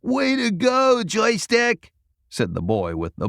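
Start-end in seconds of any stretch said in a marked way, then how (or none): 0.0-1.9 put-on cheering boy. A narrator's reading.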